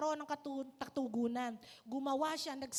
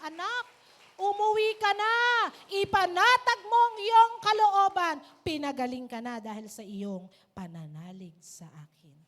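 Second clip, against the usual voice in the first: louder, higher proclaimed blessing; loud Jesus blessing; 4 semitones higher, 12 dB louder than usual. Acted. Jesus proclaiming the woman healed, loud and high. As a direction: cry the blessing out to everyone.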